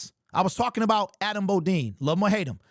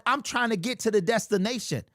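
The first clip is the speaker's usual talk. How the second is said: ordinary talk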